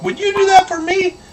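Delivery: very high, loud cartoon voice; squeaky, hammed-up hopeful plea; grateful little bird. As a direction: ask with wide-eyed hope as a tiny bird. Hopeful bird asks the wolf.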